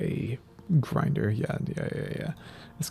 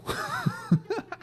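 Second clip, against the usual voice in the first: breathless surprised laugh; giddy high laugh. Real, not acted. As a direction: laugh in disbelief. Laugh after rare drop.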